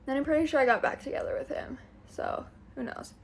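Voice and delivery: a quick sheepish whisper; whispered aside. Real, not acted. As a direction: whisper it like a confession. Sheepish aside after breakup story.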